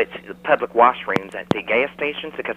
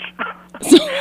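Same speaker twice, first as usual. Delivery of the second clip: squealing high laugh; a sudden high-pitched giggle; 5 dB louder than usual. Acted. Caller laughs at own joke.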